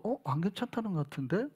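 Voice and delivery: quiet doubtful remark. Retold or imitated. Speaking quietly. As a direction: pastor doubting a church member's 괜찮아요, softly puzzled. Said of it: "라고 내가 얘기를 한 거예요".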